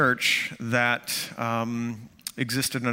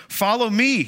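Jesus calling Matthew from the booth, raised voice; 9 semitones higher, 4 dB louder than usual. Retold or imitated. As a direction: follow me called out high and loud. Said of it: "he told him".

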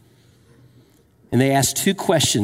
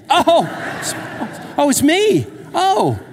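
laughing wife; 11 semitones higher, much slower than usual. Retold or imitated. Wife laughing at husband's joke.

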